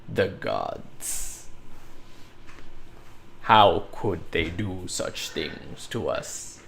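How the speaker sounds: low, slow, hammed-up despair; guy curses the gods in a low, slow, mock-tragic groan; mock lament to the sky. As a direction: shake your fist at heaven, groaning. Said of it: "just yelling".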